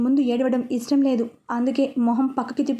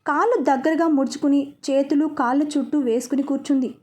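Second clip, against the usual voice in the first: ordinary delivery, nothing marked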